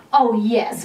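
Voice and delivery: ordinary talk, nothing marked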